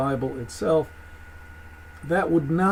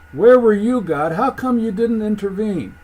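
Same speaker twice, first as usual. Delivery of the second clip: higher-pitched, indignant accusation; accusing challenge; 5 semitones higher, 6 dB louder than usual. Acted. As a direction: demand an answer, hitting "where" loud. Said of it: "could be read with the intonation".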